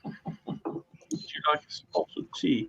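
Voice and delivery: a quiet, mild English accent; posh English accent. Shown as a demonstration. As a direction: offer tea like a polite Englishman. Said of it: "no, Texas".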